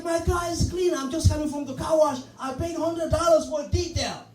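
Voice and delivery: high, pleading protest. Retold or imitated. Pleading with the windshield washer, high and insistent. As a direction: beg him to stop, voice up high. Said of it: "I was like".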